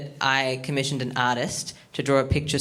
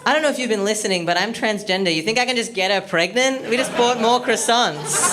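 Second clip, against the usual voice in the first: mock indignant; 8 semitones higher, 4 dB louder than usual.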